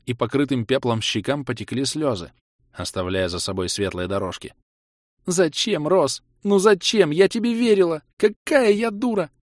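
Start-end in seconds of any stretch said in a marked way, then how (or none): 5.3-9.3 high, distraught woman's cry; anguished high-pitched reproach; tearful wailing. A narrator's reading. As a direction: cry out, hurt and betrayed. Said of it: "в голос всхлипнула девушка".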